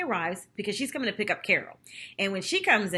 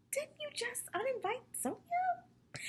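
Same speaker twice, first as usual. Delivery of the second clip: slow, much higher, softer pointed question; mock accusation; 10 dB quieter, slower than usual. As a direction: drag out the gotcha, high and slow. Speaker mock-challenges Ramona.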